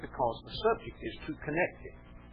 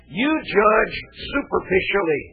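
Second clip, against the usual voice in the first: higher, lightly voiced Pharisee, a hint of scorn; accusing Pharisee; 9 semitones higher, 8 dB louder than usual. Pharisees sneering at Jesus, a hint of scorn. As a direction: throw the charge back at him.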